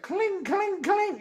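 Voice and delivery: high, soft cling cling; squeaky guitar imitation. Host mimicking the dubbed guitar in shred videos, high squeal. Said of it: "when they play the guitar, it's like".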